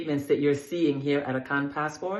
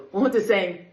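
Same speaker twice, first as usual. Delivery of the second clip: louder call "wo to saying" for response; teacher prompt; 5 semitones higher, 6 dB louder than usual. Shown as a demonstration. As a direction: prompt the class to answer. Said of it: "you got it".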